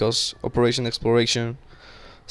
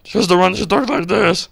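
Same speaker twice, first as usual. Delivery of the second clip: high-pitched, louder Satan alter ego; Satan personality; 8 semitones higher, 4 dB louder than usual. Shown as a demonstration. Streamer demonstrating their second personality, high Satan voice. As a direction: put on a high demon voice for your evil side. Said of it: "like, fucking Satan".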